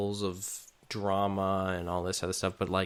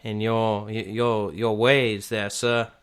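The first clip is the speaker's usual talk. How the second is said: a menacing Southern local; Southern accent; 3 semitones higher, 9 dB louder than usual. Retold or imitated. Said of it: "more in a southern accent".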